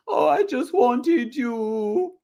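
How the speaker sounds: very high, slow simpering whine; mock simpering Julia. Mocking Julia clinging to her friends, high slow coo. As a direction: coo it high and drawn out like a lapdog. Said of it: "And Julia's just".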